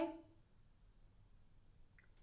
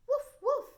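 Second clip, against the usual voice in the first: high, loud yappy woof woof; dog barking; 8 dB louder than usual. Shown as a demonstration. Teacher voicing the little dog for kids, high yappy barks. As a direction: bark like a tiny puppy. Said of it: "said a little dog".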